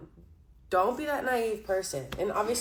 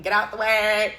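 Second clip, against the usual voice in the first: louder, shoving-through command; mock pushy shout; 3 semitones higher, 9 dB louder than usual. Reader imitating the boy shoving people aside, louder. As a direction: bark it like pushing through a crowd. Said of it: "knocking people over, like".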